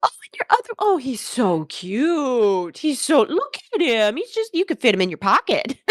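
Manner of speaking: high, sweet, gushing Southern mom; high chirpy mom voice, all charm; Honey the mom. Acted. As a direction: gush like an overfriendly realtor mother. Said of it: "Honey goes".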